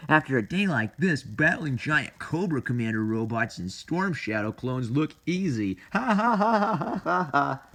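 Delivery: boast, then burst into high staged ha-ha laughter; cartoon hero boast. Tunnel Rat after a hard day, cartoon boast.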